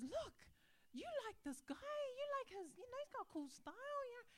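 high, eager cheering-up voice; retold coaxing. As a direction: talk up the pictures to comfort a child. Coaxing her crying son with photos.

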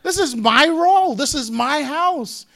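high, loud, incredulous protest with a laugh; mock outrage. Husband to an intruder in his house, loud mock outrage. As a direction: ham up the outraged husband defending his home. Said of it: "I'm just gonna sit there and go".

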